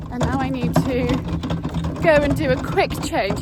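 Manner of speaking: talking loudly over yard noise. Real, not acted. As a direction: speak up over a noisy wheelbarrow. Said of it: "you can't hear with rattling wheelbarrow".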